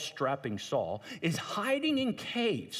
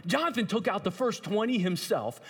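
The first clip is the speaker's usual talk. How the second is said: normal talking voice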